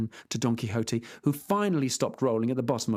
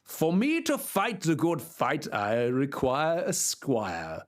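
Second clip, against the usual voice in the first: put-on slow, lofty old knight voice; grand old knight; slower than usual. Knight asking for a squire, slow and lofty. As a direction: declaim it slowly like a noble old knight.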